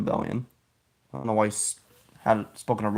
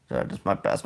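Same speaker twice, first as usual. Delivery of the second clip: ordinary talk, nothing marked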